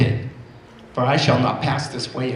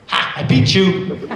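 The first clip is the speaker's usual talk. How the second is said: put-on teasing little girl; 5 semitones higher, 4 dB louder, slower than usual.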